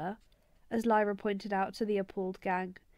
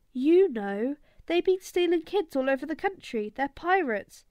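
a lightly voiced street kid, a bit higher and louder; Egyptian boy, alarmed; 4 semitones higher, 6 dB louder than usual. Egyptian boy telling the gang about the gobblers, eager and alarmed. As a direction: spill the rumour eagerly, like a scared kid.

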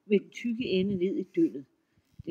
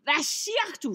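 shrieking troll; 8 semitones higher than usual. A narrator's reading. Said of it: "skreg trolden".

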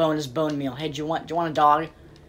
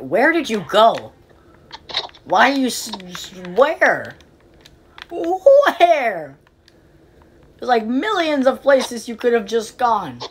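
exasperated searching; 8 semitones higher, 7 dB louder, slower than usual. Real, not acted. Frantic search for villager.

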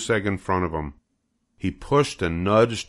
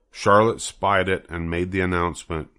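usual speaking voice

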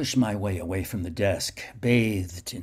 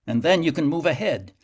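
firm urging; 6 semitones higher, 6 dB louder than usual. A narrator's reading. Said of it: "Mila told me".

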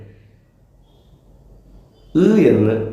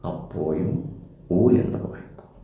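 ഉ എന്ന് വായിക്കും whispered, no voice; whispered hint of the answer; 4 semitones lower, 6 dB quieter than usual. Shown as a demonstration. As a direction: breathe the answer like a prompt to the pupil.

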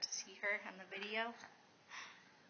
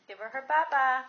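loud urging; 3 semitones higher, 15 dB louder than usual. Real, not acted. Parent urging toddler to feed doll.

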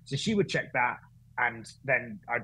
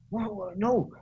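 higher-pitched Welsh impression; Welsh accent; 8 semitones higher, slower than usual. Race director halts at the drivers' order, high Welsh accent. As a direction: put on her Welsh voice, pitched up. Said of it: "That was a great Welsh accent".

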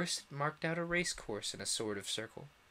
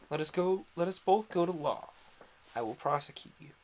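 louder, higher bullying dog; Fury's threat; 3 semitones higher, 6 dB louder than usual. A narrator's reading. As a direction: threaten like a blustering cur.

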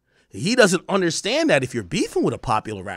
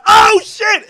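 high, loud retold shout of shock; shocked outburst; 8 dB louder than usual. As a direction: blurt it out, reliving a wild moment. Retold reaction to seeing a slap.

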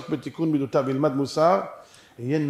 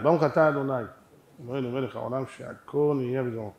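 low, soft murmured blessing; real blessing said aside; quiet blessing; 4 semitones lower, 5 dB quieter than usual. Real, not acted. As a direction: recite it softly to yourself. Real blessing said aside before drinking.

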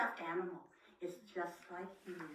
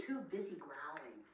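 lightly growly bobcat; 6 semitones lower, 4 dB quieter than usual. Acted. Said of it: "said the bobcat".

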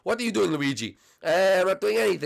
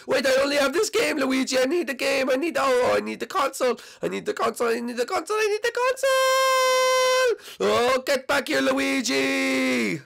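hammed-up desperate wail, mostly higher; frantic pleading; 7 semitones higher than usual. Mario chases Luigi for Switch.

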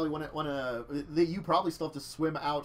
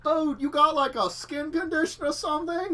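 surfer dude voice; 10 semitones higher, 4 dB louder than usual. Acted. GM voices surfer yacht owner.

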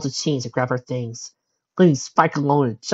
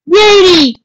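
long high shout of a name; calling out; 14 dB louder, slower than usual. A narrator's reading. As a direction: call loudly for a lost daughter. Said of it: "Magnum called out".

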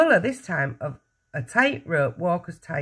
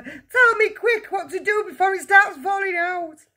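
high, loud panicked wail; 5 dB louder than usual. A narrator's reading. Father begs for a remedy, wailing. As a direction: plead urgently at the top of the voice. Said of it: "wailed the father".

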